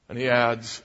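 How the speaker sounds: ordinary talk, nothing marked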